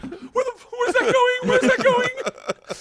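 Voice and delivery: very high, loud frantic shriek; mock panic. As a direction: squeal the question in hammed-up terror, half laughing. Panicking at prop, retold.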